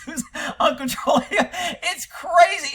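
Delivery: high-pitched words squeezed through laughter; real amused laughing. Real, not acted. Host riffing on drug ad side effects, laughing. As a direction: draw it out while cracking up.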